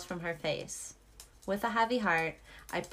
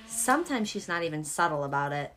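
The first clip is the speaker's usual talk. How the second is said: ordinary talk, nothing marked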